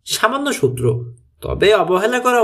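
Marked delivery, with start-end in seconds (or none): none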